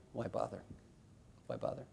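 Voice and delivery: very quiet, throwaway; hushed why bother; soft dismissive shrug. Real, not acted. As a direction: drop it almost to nothing. Dismissive repeated question.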